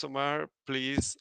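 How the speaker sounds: ordinary talk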